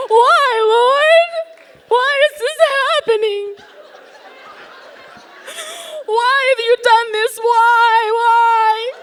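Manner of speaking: high, loud, anguished wail to God; retold sobbing plea, loud and high; wailing cry. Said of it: "I am crying so hard".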